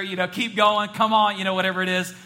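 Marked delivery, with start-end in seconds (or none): 0.3-1.4 loud mock cheering-on; raised, louder shout-back; imitated congregation shout. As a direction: imitate the pews urging the preacher along. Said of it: "when you guys shout back at me".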